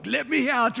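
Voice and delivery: high, panicky retold plea to be let out; mock-panicked cry from the shut car; panicked dad. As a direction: mimic a trapped man begging to get out. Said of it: "just shaking the door".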